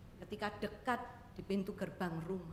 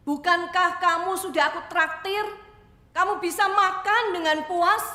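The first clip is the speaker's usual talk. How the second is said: emphatic questioning; 9 semitones higher, 13 dB louder than usual. Acted. Owner asks crying girl.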